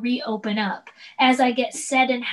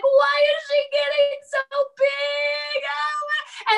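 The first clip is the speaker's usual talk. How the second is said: high, slow, sung-out sob of a question; tearful wail; 4 dB louder, slower than usual. Retold or imitated. Mother crying to herself that her daughter grows up too fast, high slow wail. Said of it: "I'm literally crying".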